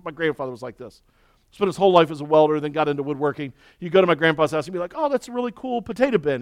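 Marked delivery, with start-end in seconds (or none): 5.0-6.4 quick, higher excited praise for the bin; admiring. Retold or imitated. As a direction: gush over the grandpa's potato bin. Visitor admiring grandpa's bin.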